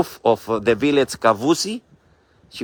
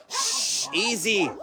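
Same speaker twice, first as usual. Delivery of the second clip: soothing hush; 6 semitones higher, 9 dB quieter, slower than usual. Real, not acted. Calming dogs she woke.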